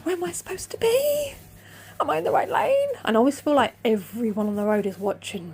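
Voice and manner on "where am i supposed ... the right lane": high, panicky self-quote; imitating her own motorway panic; panicked. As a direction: squeal it like you're lost in traffic.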